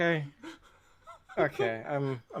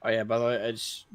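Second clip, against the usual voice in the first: ordinary talk, nothing marked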